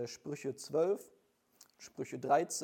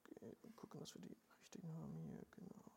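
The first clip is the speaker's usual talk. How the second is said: a real hushed whisper under his breath; quiet aside while finding the right verses; 2 semitones higher, 21 dB quieter than usual. Real, not acted.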